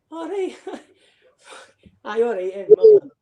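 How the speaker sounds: high, laughing giggle through the words; squeaky laughing reply. Real, not acted. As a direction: crack up while agreeing. Laughing at going to Vegas.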